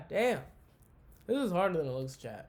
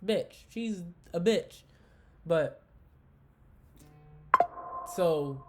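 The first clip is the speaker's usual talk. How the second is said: ordinary delivery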